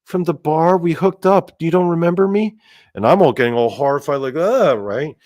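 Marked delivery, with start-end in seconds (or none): 0.0-2.6 put-on high, eerie sing-song girl voice; creepy girl. Ghost girl from the dream coaxing the narrator, high and eerie. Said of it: "And she's like". 4.3-4.8 disgusted ugh. Retold or imitated. Recoiling from the girl.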